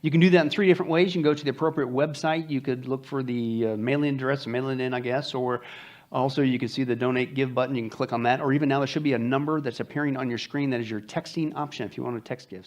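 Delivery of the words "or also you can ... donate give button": normal talking voice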